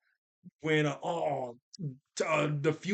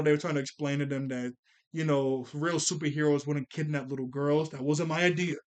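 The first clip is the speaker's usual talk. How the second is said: normal talking voice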